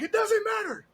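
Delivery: high squeaky mock of the neighbour; mocking the neighbour in a high put-on voice. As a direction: squeak it out like a cartoon version of him. Neighbour quoted high, far.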